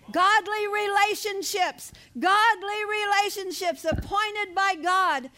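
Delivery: preaching. Real, not acted. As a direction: drive each point home to the congregation. Preaches at raised force.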